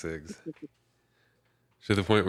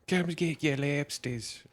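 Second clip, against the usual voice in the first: put-on Boston accent; 8 semitones higher than usual. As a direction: hawk lobsters in a thick Boston accent. Mock lobster shack pitch.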